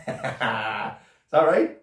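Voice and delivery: slow laughing question. Acted. Prisoner teasing cellmate about nookie, slow laughing question. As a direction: chuckle through the words.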